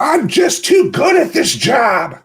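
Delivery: high, loud, drawn-out mock scream; hammed-up anguished yell; screamed confession. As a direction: scream it out like a group-therapy confession. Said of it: "go in the circle and scream".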